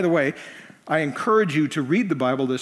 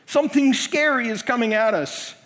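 hammed-up fear in the boat; mock panic; 6 semitones higher than usual. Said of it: "they're all freaking out in the boat".

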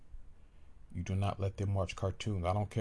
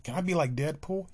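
put-on higher, louder excited kid; child voice; 7 semitones higher, 4 dB louder than usual. Child asking daddy about Spider-Man, high and eager. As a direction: ask it like a thrilled little boy.

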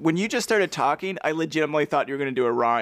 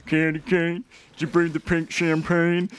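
quoting a character; 2 semitones higher than usual. Retold or imitated. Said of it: "that's a Joyride reference".

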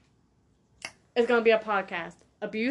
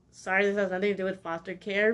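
usual speaking voice

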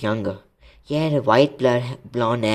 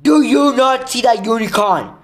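high, full-force angry bellow; 12 semitones higher, 9 dB louder than usual. A narrator's reading. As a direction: bellow the question at an opponent. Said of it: "Firenze bellowed at Bane".

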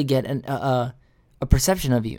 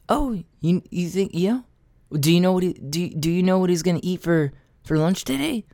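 sarcastic mockery; 3 semitones higher than usual. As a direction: pitch up and sneer the question back. Mocking the receptionist's know-it-all tone.

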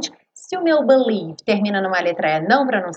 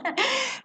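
short laugh; 12 semitones higher, 8 dB quieter than usual. Real, not acted. Laughing after own joke.

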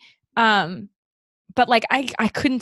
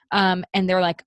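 normal talking voice

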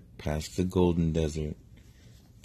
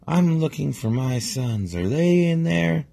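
high, loud duck shouting up; yelling up; 11 semitones higher, 6 dB louder than usual. Acted. Big duck shouts up to princess, asking for sons. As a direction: call up to the tower, worried. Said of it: "he yells back up".

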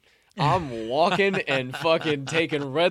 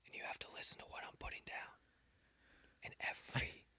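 whisper; 2 semitones lower, 18 dB quieter than usual. Shown as a demonstration. Showing friend the power of only whispering, deliberate whisper. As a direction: whisper so they hang on every word. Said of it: "if I can only whisper".

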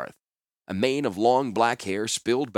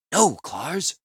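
pleading woman; 7 semitones higher than usual. Acted. Orm's voice in dialogue.

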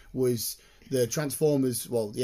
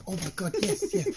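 excited yes; 4 semitones higher than usual. Retold or imitated. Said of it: "And I'm like".

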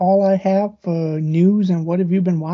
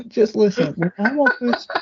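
trying not to laugh; 3 semitones higher than usual. Real, not acted. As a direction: voice cracking into laughter. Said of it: "come on, don't laugh".

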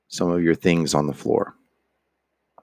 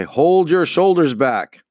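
sharp, higher, louder fatherly rebuke; stern frowning reply; 10 semitones higher, 5 dB louder than usual. A narrator's reading. Father scolding his waving son, a stern raised command. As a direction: bark the order at the little boy. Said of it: "And I frowned. And I said in reply".

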